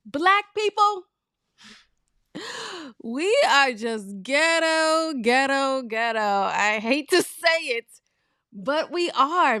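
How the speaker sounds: loud, high and drawn out, fed up; exasperated loud declaration. Real, not acted. Vlogger loudly complaining to viewers about her people.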